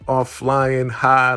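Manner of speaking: ordinary delivery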